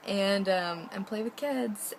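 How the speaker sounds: lightly hammed-up eagerness; retold excitement. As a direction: gush about getting to go. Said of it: "so excited".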